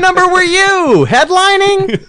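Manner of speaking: high, loud Yiddish uncle voice; Yiddish accent. Retold or imitated. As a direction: Yiddish accent, laughing through it. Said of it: "that was your Yiddish accent".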